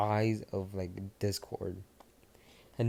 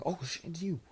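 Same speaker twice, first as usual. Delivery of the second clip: startled gasp; 9 semitones higher than usual. Acted. Bakugo spots feared Discord.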